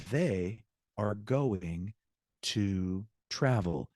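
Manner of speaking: slow, drawn-out teacher's example; word-by-word. Shown as a demonstration. Teacher giving students a sentence to translate, slow and word-by-word. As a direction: stretch each word, pausing for learners to translate.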